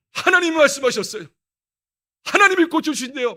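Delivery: loud, high, confident announcement; bold declaration. Retold or imitated. Son boldly telling mother God will heal him, loud. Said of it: "얼마나 당당한 이야기하는지".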